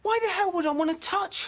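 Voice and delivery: appalled refusal. Acted. English visitor told to touch the corpse, appalled. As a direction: recoil in high-pitched horror. Recoils at touching dead body.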